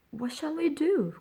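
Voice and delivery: high, alarmed cry; frightened cry. Acted. Missus Sowerberry panicking over the locked-up boy, high frightened cry. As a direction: fret aloud in sudden fear. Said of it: "cried Missus Sowerberry".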